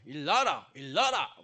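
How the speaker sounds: mock Gyeongsang wake-up call to the kids; lightly voiced Gyeongsang mom waking her sons; 경상도 사투리로 깨우기; Gyeongsang dialect. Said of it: "경상도 사투리".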